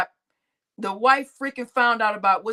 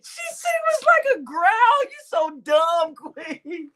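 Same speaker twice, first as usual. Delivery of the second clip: laughing shout; 5 semitones higher than usual. Real, not acted. Streamer teasing Queen, high laughing shout. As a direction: shout it out cracking up. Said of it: "laughing shout".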